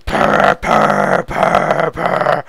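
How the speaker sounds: low, rolling purr sounds; cat purring. Retold or imitated. Imitating the cat purring on his return, low purr. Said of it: "he'll go".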